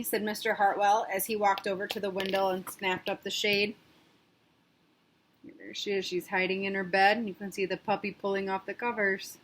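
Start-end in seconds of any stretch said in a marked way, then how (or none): none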